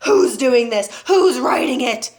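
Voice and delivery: loud, forceful demand; yelling. A narrator's reading. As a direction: yell it at nobody in particular. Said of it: "she yelled at nobody in particular".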